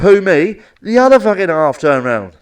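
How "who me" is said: loud shouted reply. Retold or imitated. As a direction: call back across the street. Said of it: "when he shouted".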